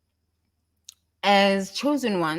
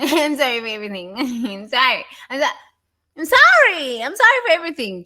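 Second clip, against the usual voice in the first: higher, louder, rushed fake sorry; mock apology; 7 semitones higher, 7 dB louder than usual. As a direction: parody a hollow, gushing apology. Mocking someone's empty apology.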